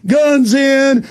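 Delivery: mocking, slow, high hammed-up patriot voice; sarcastic proud patriot. Host mocking a loyal citizen with a slow sarcastic shout. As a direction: sneer it like a brainwashed flag-waver. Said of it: "and you'll go".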